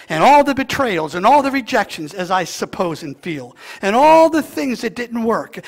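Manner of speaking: loud, high, fervent preacher climb; soaring loud pulpit cry; full-force preaching. Real, not acted. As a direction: preach it out to the whole church.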